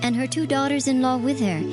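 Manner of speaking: ordinary talk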